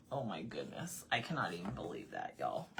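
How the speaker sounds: low, soft stunned aside; low muttered amazement; hushed disbelief. Retold or imitated. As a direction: sink into quiet, worn-out disbelief. Own stunned reaction, retold.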